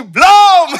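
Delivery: loud, high mock shout; inner outburst. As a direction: yell the secret truth. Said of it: "Oh, dalam hati".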